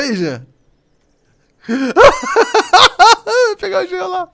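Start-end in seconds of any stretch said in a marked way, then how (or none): 1.7-4.3 high giggling glee; laughing. Real, not acted. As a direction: laugh through the words. Laughing as seeker approaches teammate.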